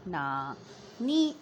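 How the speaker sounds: low, slow नानी split into two syllables; sounding out. Shown as a demonstration. Teacher repeating the blend for pupils, नानी sounded out slowly. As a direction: say नानी as long separate syllables. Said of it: "join करना और sound निकालना".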